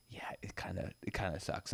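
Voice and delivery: breathy whisper, barely audible; whispered reply. Retold or imitated. Tow dispatcher confiding to stranded caller, whispered. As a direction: hush it like a tired confession. Retold roadside assistance operator.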